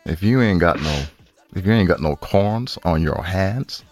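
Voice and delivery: ordinary delivery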